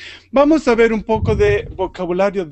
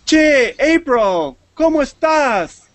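higher, slow friendly "Che, April"; upbeat model greeting for students; cheerful greeting demo; 7 semitones higher, 4 dB louder, slower than usual. Shown as a demonstration. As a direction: greet her like a buddy, drawn out. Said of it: "Entonces yo digo".

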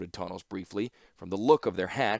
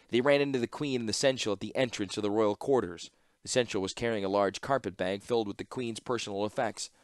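ordinary delivery, nothing marked

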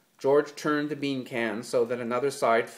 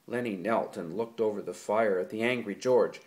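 usual speaking voice

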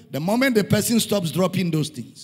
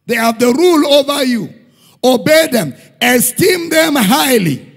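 full-force preaching; 5 semitones higher, 9 dB louder than usual. Acted. Pastor thundering at his church that it destroys pride. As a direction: pound each phrase at the congregation. Preaching peak, loud throughout.

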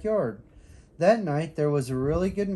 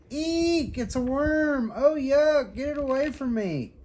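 high squeal, eek at a worm; high disgusted squeal; 11 semitones higher than usual. A narrator's reading. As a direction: shriek like a girl who found a worm. Girl finds worm in box.

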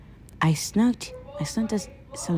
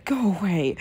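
ordinary talk

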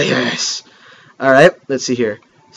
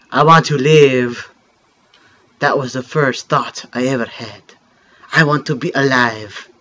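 softer, lower storytelling read; story narration; 4 semitones lower, 4 dB quieter than usual. Acted. Gamer reading the game's opening story, soft narration. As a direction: read the legend like a mock French narrator. Reads game intro narration aloud.

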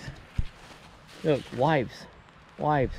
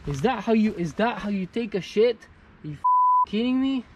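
high-pitched outraged question; outraged disbelief; 7 semitones higher than usual. Real, not acted. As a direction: voice flies up, unable to believe it. Outraged at litterers' wipes.

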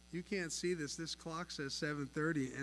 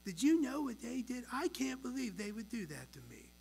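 higher mock childish whine; preschooler voice; 7 semitones higher than usual. Preacher imitates churchgoers gossiping like preschoolers, higher whiny. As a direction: whine like a hammed-up little kid. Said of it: "we sound like a bunch of preschoolers".

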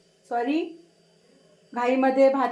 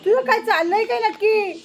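high-pitched telling-off of a pet; raised pitch, fondly fed up; exasperated scolding; 5 semitones higher than usual. Real, not acted. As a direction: demand an answer from the noisy pet.

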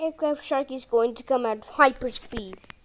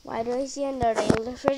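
ordinary delivery

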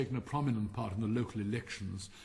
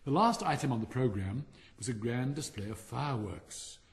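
plain reading voice

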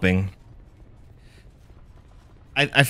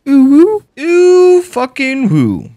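high, drawn-out whooping; excited whoop; 13 semitones higher, 7 dB louder, much slower than usual. Real, not acted. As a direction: whoop it out high, then slide down. Whoops during game play.

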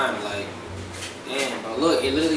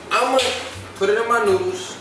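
excited; 8 semitones higher, 3 dB louder than usual. Real, not acted. Hyping up the spicy challenge.

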